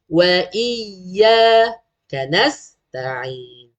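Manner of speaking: "iyyaka" very slow, y held; pressed doubling. Shown as a demonstration. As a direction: recite "iyyaka" leaning on the double y. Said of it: "kena tekan". (baca "iyyaka" perlahan, tekan sabdu)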